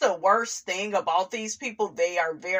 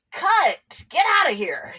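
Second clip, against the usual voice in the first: mock dismissal; 8 semitones higher than usual. Shooing Roger off.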